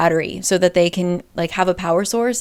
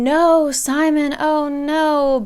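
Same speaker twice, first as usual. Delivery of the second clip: mock scream; 6 semitones higher, much slower than usual. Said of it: "Everyone is screaming".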